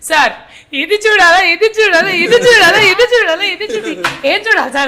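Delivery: exasperated complaint. Retold or imitated. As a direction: whine it like an overloaded actor, half crying. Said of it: "Naresh గారు ఒక రోజు సెట్లో".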